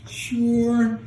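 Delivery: high-pitched, stretched "sure"; mock insecure answer. Agreeing to a punch in the face, high and insecure. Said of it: "I think it's an insecure answer".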